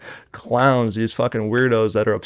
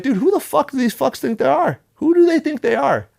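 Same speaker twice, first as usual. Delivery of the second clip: high, incredulous outrage at the promoters; mock-furious, voice jumping way up; angry rant; 13 semitones higher than usual. Telling his agent off, high and outraged. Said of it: "I was like".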